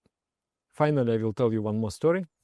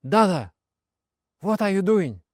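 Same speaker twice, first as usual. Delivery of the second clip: louder, higher, a hint of a worried friend; friend's alarmed call; 7 semitones higher, 5 dB louder than usual. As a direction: call him back to his senses. Friend shaking drunk narrator.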